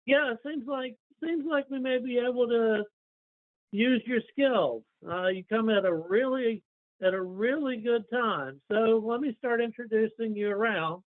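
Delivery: high, welcoming character voice; Torjan in character. Acted. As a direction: pitch it up and accept the offer agreeably.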